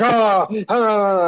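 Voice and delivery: imitating a pained "ah, ah" cry; retold groan of pain from a maced coworker. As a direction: mimic a guy with mace in his eyes, wincing.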